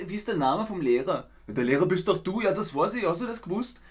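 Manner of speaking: two Austrians talking in thick dialect; Austrian dialect dialogue. Shown as a demonstration. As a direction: act out a quick Austrian chat. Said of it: "Wie zum Beispiel in dem Satz".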